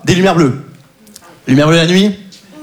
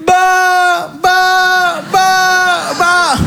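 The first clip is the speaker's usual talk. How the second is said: high sustained mock drone; mock exercise voice; 10 semitones higher, much slower than usual. Comedian mimicking his therapy drill, high and drawn out. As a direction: stretch each word high and flat like a vocal drill.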